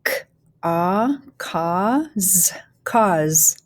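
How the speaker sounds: "cause" built up sound by sound, then whole; teacher blending "cause" with gaps between sounds; phonics blending. Shown as a demonstration. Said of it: "go ahead and start blending".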